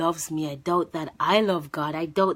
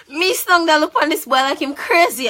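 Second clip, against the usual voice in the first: excited; 10 semitones higher, 8 dB louder than usual. Real, not acted. Excited retelling, very loud.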